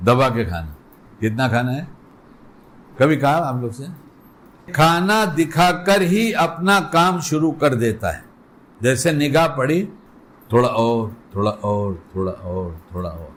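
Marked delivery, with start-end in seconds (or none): 0.1-1.9 inner voice. Retold or imitated. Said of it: "پھر اندر سے ایک آواز آتی ہے". 10.5-13.3 low, coaxing tempter voice, fading away each time; tempting inner voice. Retold or imitated. The inner voice urging one more bite, a fading low coax.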